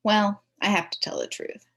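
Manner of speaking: ordinary delivery, nothing marked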